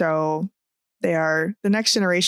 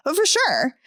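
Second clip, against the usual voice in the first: raised, breezy "Oh, for sure"; imitating coworker; 9 semitones higher than usual. Mimicking coworker answering the boss casually. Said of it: "it's always in that tone too".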